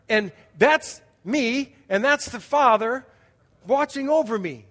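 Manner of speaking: loud, high, slow preaching build; rising sermon fervour; preaching at full force. Acted. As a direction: proclaim it to the hall.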